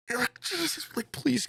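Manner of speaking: put-on pleading with the drunk guys to stop; exasperated plea. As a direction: beg them to stop, worn out.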